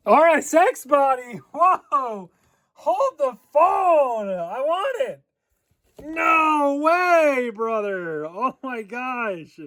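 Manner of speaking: high, slow, amazed squeal; losing it over a dream car body; real fanboy excitement; excited gush. Real, not acted. Spotting the RSX body kit.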